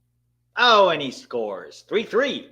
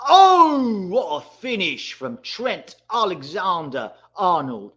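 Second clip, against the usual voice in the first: a huge high loud oh, then slow praise; excited goal call; 6 semitones higher, 6 dB louder, slower than usual. Real, not acted. Streamer calling a penalty goal, excited. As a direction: erupt at the finish, then savour it.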